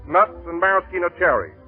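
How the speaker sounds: ordinary talk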